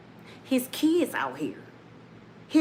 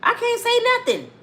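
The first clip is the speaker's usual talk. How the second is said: emphatic; 13 semitones higher than usual. Real, not acted. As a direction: blast "I can't say nothing" out. Comedian restating emphatically.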